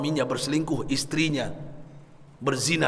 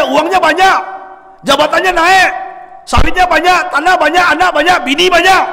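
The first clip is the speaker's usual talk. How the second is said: loud, high, driving sermon voice; fiery preacher pounding the point home; preaching at full force; 13 semitones higher, 10 dB louder than usual. Acted. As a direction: thunder it out from the pulpit.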